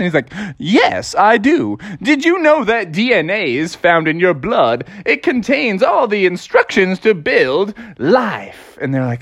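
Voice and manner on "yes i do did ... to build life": sing-song cartoon presenter, higher pitch; swooping educational mascot with drawn-out endings; chirpy Mister DNA. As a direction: announce it like a cartoon science host.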